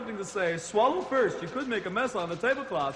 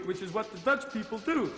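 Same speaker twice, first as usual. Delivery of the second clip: normal talking voice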